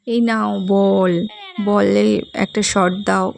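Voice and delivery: slow, with বল drawn out long; stress on বল. Shown as a demonstration. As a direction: stretch and weight the word বল. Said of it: "বল শব্দটির ওপর বেশি জোর". (বল শব্দে টেনে জোর দিয়ে ধীরে)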